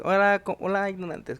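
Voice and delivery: hammed-up scorn for the students; mocking teacher greeting. Teacher greeting her class of students, loud mock contempt. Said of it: "si esas bola de ignorantes".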